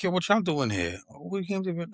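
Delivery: high, startled call out to friends; surprised greeting. Retold or imitated. Spotting the friends at a Brooklyn event, high and surprised. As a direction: act caught off guard seeing them. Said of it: "and I'm like".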